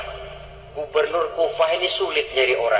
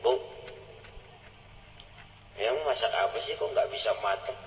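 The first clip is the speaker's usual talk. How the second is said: quiet, gentle questioning; hushed, low voice; 10 semitones lower, 12 dB quieter than usual. Acted. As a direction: lower and softer, near the sleeping child. Umar approaches sleeping child quietly.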